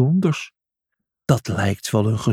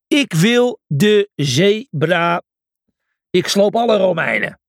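roaring; 7 semitones higher, 4 dB louder than usual. Acted. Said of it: "brulde hij".

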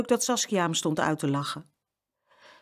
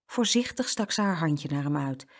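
ordinary delivery, nothing marked

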